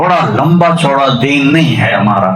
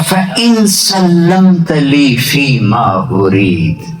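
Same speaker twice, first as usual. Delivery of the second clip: Quranic recitation; 2 semitones higher than usual. Acted. Quoting divine words.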